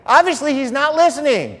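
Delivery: high, loud fed-up outburst; exasperated. Retold or imitated. Pastor imagining himself fed up with the priests, exasperated. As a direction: blurt it out at the end of your rope. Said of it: "I'd have been pulling the hair".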